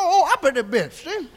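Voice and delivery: ordinary talk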